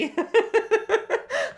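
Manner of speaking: loud, high cackle of laughter; real helpless laughing fit. Real, not acted. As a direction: burst into high-pitched laughter. Cracks up at own joke.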